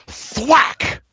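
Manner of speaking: sudden high, loud thwack; imitated thwack. Game master voicing a sniper shot, sharp loud thwack. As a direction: crack out a shot sound effect.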